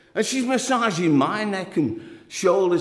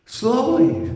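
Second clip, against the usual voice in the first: higher slowly; 6 semitones higher than usual. Retold or imitated. Lover repeating her plea, pitched higher. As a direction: press it again, pitched up. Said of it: "she says".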